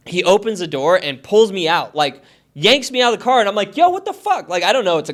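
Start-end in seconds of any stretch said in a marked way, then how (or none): 3.8-4.5 high-pitched retold disbelief; mock shocked protest. Man retelling being yanked from his car, mock shocked. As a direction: protest it high, imitating your startled self.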